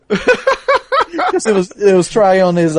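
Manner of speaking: slow, high words through laughter; laughing while talking. Real, not acted. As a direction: talk through the giggles.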